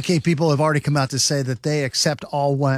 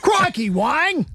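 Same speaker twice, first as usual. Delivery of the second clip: high, slow hammed-up Australian; exaggerated Aussie exclamation; Australian accent; 12 semitones higher, slower than usual. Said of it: "in an Australian accent".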